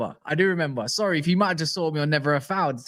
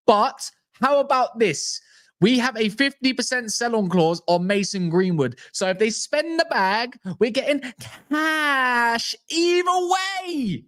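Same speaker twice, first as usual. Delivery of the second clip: rising, drawn-out excited boast; gleeful; 10 semitones higher, slower than usual. Real, not acted. Fan gloating to viewers about transfer cash. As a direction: crow about the windfall, voice climbing.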